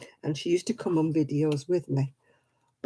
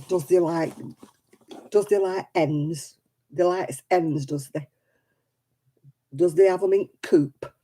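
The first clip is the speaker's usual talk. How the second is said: broad Oldham accent, dropped h's, they has and does they; friendly broad Oldham accent. Shown as a demonstration. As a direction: chat in thick Oldham dialect like back home. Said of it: "say something with my old accent".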